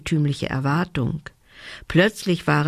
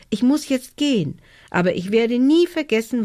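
high, lightly voiced elf; a hint of a small elf's voice; grateful little elf; 7 semitones higher than usual. A narrator's reading. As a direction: say a heartfelt goodbye, pitched up.

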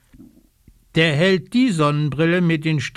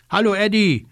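calling out; 7 semitones higher, faster than usual. Acted. Socke calling out to Eddie Bär, cheerful call. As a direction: call across to a friend. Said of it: "ruft Socke".

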